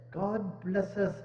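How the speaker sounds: usual speaking voice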